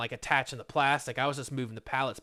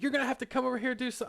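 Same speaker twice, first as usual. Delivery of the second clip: mock nagging coworker; 10 semitones higher, much faster than usual. Rita telling the worker to stop standing around, mock nagging voice. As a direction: nag him like a petty supervisor. Coworker orders him over.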